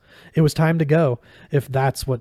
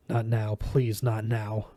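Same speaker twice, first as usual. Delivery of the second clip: quiet plea; 2 semitones lower, 7 dB quieter, slower than usual. A narrator's reading. Quentin pleads as Julia changes.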